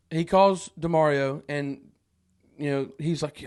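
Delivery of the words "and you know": ordinary talk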